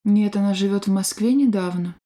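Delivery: ordinary talk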